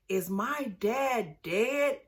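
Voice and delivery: put-on slow, soft little-girl question, rising; lightly voiced child, slowly; small child asking. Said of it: "telling her".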